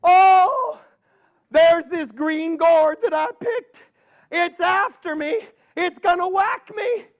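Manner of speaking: put-on high, panicky old woman; frightened old lady. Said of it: "And she says".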